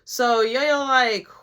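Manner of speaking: ordinary delivery, nothing marked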